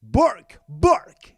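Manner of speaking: loud, punchy spoken barks; sharp, booming dog-bark words; barking pit bull. Acted. Announcer plays pit bull barks.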